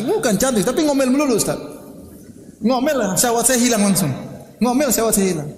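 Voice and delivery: high-pitched exasperated imitation; complaining. Friend explaining his wife's nagging to the ustaz, high exasperated complaint. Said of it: "tempat banyak keluhan".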